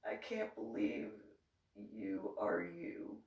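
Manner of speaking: low, very soft grandpa voice; slow, hushed disbelief; quiet disappointed scolding. Retold or imitated. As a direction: scold under your breath, acted. Grandpa scolds Mary in low murmur.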